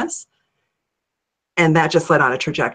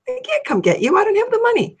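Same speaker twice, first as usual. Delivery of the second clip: much higher, rising in alarm; panicked protest. Retold or imitated. Answering the calling car, panicking over money. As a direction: squeak out the refusal in a panic. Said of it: "I'm like".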